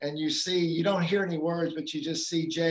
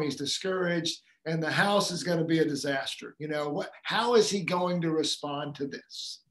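usual speaking voice

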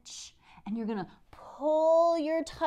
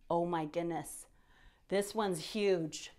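ordinary talk, nothing marked

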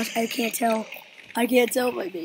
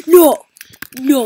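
shout; 15 dB louder than usual. Real, not acted. Losing in the game.

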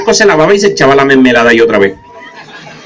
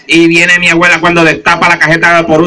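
ordinary talk

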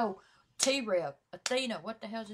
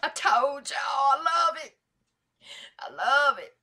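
loud, higher-pitched hype reaction; excited shout; 5 semitones higher, 8 dB louder than usual. Real, not acted. Reactor hyping a song to viewers, excited shout. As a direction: shout your love for the track.

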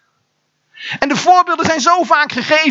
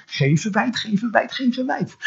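soft, lowered repeated aside; quiet disclaimer; 3 semitones lower, 7 dB quieter than usual. Real, not acted. Preacher softening a question about latecomers, quiet aside. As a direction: drop the voice and say it softly.

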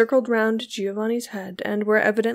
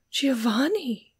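slow, higher cry of the name, falling away; shocked exclamation; 4 semitones higher, slower than usual. A narrator's reading. Beatrice thunderstruck by Giovanni's words, exclaiming. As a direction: exclaim the name in drawn-out disbelief. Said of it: "exclaimed Beatrice".

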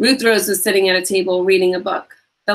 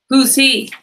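higher, louder tough-girl bad guy; 6 semitones higher, 5 dB louder than usual. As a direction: play a tough crook, demanding. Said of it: "she asked in her bad guy voice".

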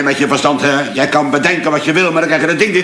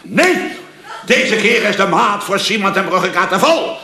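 higher, worked-up vow; defiant outburst; 6 semitones higher than usual. Acted. Bullied man vowing to finally hit back, worked up. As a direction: swear revenge, fired up.

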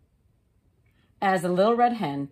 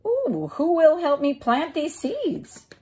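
high, bright hen voice; little red hen; 10 semitones higher than usual. Acted. The hen asking neighbours about seeds, in a high voice.

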